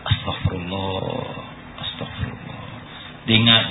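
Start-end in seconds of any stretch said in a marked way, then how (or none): 0.1-1.3 Astagfirullah murmured low and slow; low murmured repentance. Acted. A man repenting alone at night, murmuring low and slow. 1.8-2.6 astagfirullah whispered, breath only; whispered repentance. Acted. The same repenting man, now barely whispering.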